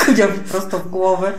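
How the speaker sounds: normal talking voice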